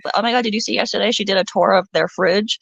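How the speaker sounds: ordinary talk, nothing marked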